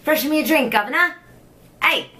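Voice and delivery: hammed-up upper-class British voice; British toff calling for a drink; posh British accent. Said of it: "I love doing accents".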